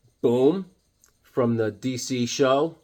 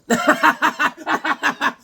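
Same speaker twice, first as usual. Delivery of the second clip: high staccato laugh; high, rapid ha-ha-ha; loud cackle; 13 semitones higher, 5 dB louder than usual. Real, not acted. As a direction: laugh hard at your own joke in high bursts. Laughs at own joke.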